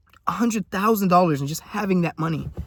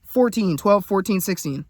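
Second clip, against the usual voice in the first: normal talking voice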